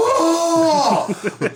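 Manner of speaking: high, loud shocked "oh"; group gasp. Retold or imitated. Friends at the party recoiling in horror, high and loud. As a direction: imitate the crowd recoiling in horror. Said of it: "everybody just goes".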